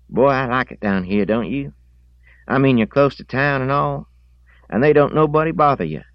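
put-on high, loud boy's voice, quickening at the end; eager country boy. Boy tells the pig's owner why he likes it here, eager high voice. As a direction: play the boy keenly talking up his camp. Voices Harrogate praising his camp.

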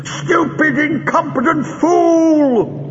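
high, slow, seething scream; the enraged master berating his servant; raging insult. Acted. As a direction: drag out every insult in fury. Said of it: "screamed Greel".

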